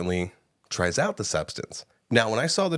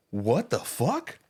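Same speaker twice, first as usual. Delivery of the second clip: slow, higher-pitched stunned question; retold post-movie bafflement; baffled disbelief; 5 semitones higher, slower than usual. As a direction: draw it out in bewildered disbelief. Retelling her stunned reaction after film.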